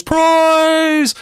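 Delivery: long, high shouted goal call; Spanish goal announcer. Retold or imitated. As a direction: stretch each call out, full throat. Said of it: "the old Spanish goal announcers".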